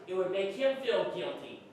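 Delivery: normal talking voice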